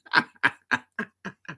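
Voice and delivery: long staccato laugh; real laugh. Real, not acted. Host retelling [name]'s jab, laughing. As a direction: laugh out, thinning to breathy pulses.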